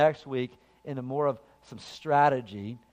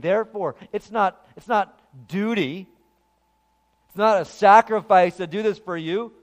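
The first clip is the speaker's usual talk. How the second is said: forceful, insistent sermon refrain; impassioned preaching; 6 semitones higher, 10 dB louder than usual. Real, not acted. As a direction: drive each point home from the pulpit.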